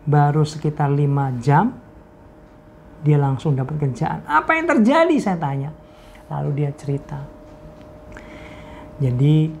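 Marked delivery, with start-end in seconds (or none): 4.2-5.1 much higher, astonished question. Retold or imitated. Pastor asks the friend what happened, astonished and high. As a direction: gasp out the question in disbelief.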